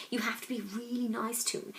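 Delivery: hushed unvoiced whisper; dad whispering. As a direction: whisper it like a secret recipe. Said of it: "He talked quietly".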